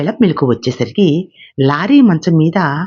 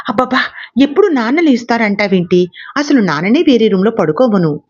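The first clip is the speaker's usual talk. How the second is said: shouting; 7 semitones higher than usual. A narrator's reading. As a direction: cry out in frustration. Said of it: "అరిచాడు lorry".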